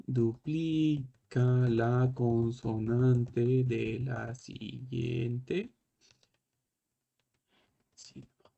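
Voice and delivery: very slow, fading to almost nothing at the end; slow emphatic rule. Shown as a demonstration. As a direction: spell out the rule word by word, trailing off. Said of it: "Importante esto". (regla dicha muy despacio, apagándose al final)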